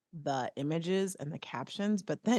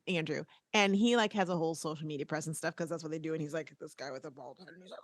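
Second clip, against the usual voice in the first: usual speaking voice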